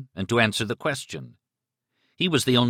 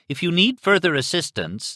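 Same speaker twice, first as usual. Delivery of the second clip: robot voice; 9 semitones higher, 3 dB louder than usual. A narrator's reading. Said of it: "the robot voice was continuing".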